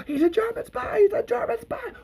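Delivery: very high squealed mock panic; panicked alarm. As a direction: raise the alarm in a squeaky falsetto. Soldier raising alarm in retold scene.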